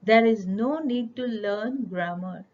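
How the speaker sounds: very slow, spaced English line; unhurried mock citation; slow quoted claim. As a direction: lay out the myth word by word. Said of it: "ऐसा सुना है मैंने".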